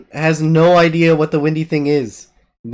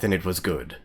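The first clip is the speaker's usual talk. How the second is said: solemn; 9 semitones lower, 10 dB quieter than usual. Acted. Solemn ending.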